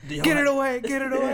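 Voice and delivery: mock scared shriek.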